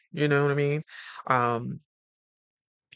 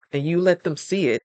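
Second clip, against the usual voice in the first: usual speaking voice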